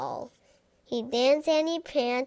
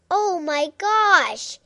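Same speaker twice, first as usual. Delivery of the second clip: dramatic exclamation; 5 semitones higher, 4 dB louder than usual. A narrator's reading. Refrain exclamation.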